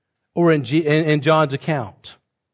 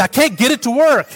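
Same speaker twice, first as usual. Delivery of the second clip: a hint of the wife's frustration, higher; lightly voiced frustrated wife; 7 semitones higher than usual. Wife annoyed at the microwave, lightly voiced frustration. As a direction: mildly mimic her annoyance at the microwave. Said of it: "she said".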